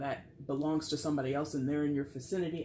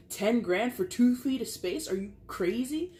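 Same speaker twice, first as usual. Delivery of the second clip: mock outrage; 7 semitones higher, 4 dB louder than usual. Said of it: "Or they're like".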